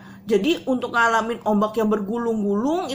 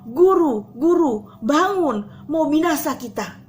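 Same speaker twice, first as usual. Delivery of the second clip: a touch of panic, louder; mild frightened call; 4 semitones higher, 5 dB louder, slower than usual. Acted. Disciples wake Jesus, mildly urgent call. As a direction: call out urgently but held back. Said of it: "Dan mereka bilang".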